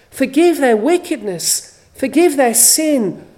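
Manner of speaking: rising, fuller preaching voice; fervent preaching. Acted. Preacher pleading Christ's prayer to the congregation, building fervour. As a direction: press the plea home with fervour.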